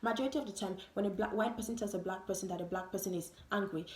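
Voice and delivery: ordinary delivery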